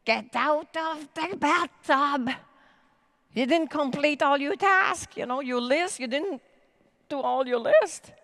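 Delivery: bossy guilty voice ordering you out of the bath; nagging inner voice. Retold or imitated. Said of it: "the little voice says".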